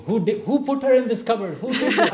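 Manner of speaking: high, laughing demand to know who did it; retelling the family's amused indignation; mock outrage. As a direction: toss the question up high with a laugh.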